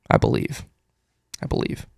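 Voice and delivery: hushed, breathless under-the-breath aside; whispered aside. Real, not acted. Host muttering doubt about a stat to himself, whispered. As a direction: murmur it to yourself, barely voiced.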